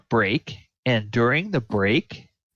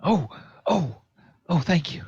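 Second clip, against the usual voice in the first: relieved victim; 7 semitones higher than usual. Acted. Frightened NPC thanks the rescuers.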